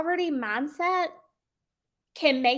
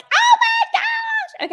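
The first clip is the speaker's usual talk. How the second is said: a much higher, louder, slow squealing "Oh my gosh"; over-the-top excitement; 7 dB louder, much slower than usual. Shown as a demonstration. Caller gushing at a phone prospect, way too excited. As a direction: overdo the excitement until it scares the listener. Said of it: "I'm not saying that you've gotta be like".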